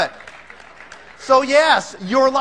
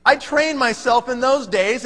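ordinary delivery

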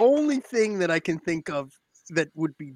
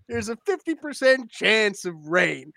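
ordinary talk

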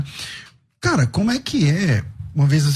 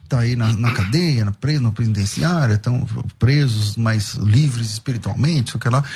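slow, lower radio announcer greeting; host imitating his own radio greeting to the prisoners; radio shout-out; 4 semitones lower, slower than usual. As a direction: draw it out like a warm on-air dedication. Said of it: "eu falo".

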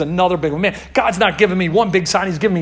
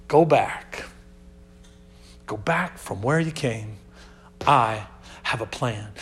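quiet low God voice; 8 semitones lower, 5 dB quieter than usual. Acted. God answering Elijah, preacher voices.

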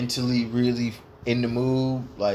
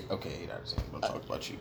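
whisper; 9 semitones lower, 13 dB quieter, much faster than usual. Real, not acted. Whispered aside to host.

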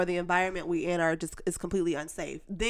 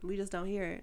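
ordinary talk

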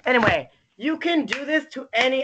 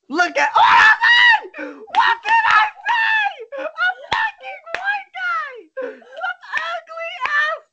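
outraged shout; 4 dB louder than usual. Real, not acted. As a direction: scream your outrage at the screen. Outraged at the director's photo.

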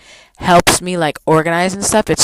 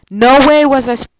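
indignant outburst; 7 semitones higher, 6 dB louder than usual. Real, not acted. Indignant outburst in retold quarrel.

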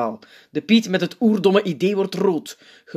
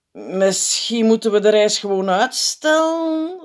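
slow, higher, tentative suggestion; aarzelend; 6 semitones higher, 4 dB louder than usual. A narrator's reading. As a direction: float the idea hesitantly. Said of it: "zegt een piet aarzelend". (voorzichtig, aarzelend)

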